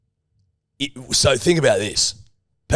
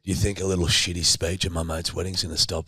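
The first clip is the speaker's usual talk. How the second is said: hushed defiant retort; 3 semitones lower, 7 dB quieter than usual. Acted. Mock-defiant retort to host.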